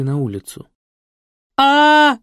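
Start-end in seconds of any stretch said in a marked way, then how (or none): 1.6-2.2 high, loud piercing "A!" scream; hysterical scream. Acted. Woman screams hysterically in the yard. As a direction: shriek in terror. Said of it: "истошно кричала женщина".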